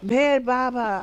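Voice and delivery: calling out Baba's name loudly, in a panic; retold shouting. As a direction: yell the name for help on a runaway horse. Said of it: "And I'm shouting at the".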